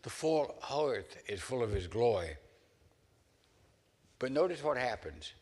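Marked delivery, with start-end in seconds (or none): 0.0-2.3 lifted, loud and awed, a touch strained; crying out. Acted. Isaiah before the Lord cries out in awe. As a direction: cry it out as if overwhelmed by glory. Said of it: "and he cries out".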